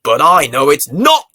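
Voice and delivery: loud, defiant insistence; firm protest. Acted. Girl contradicts gran, loud and defiant. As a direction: push back hard against the sad idea.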